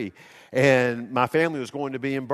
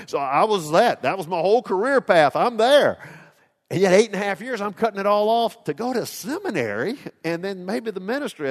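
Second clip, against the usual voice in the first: normal talking voice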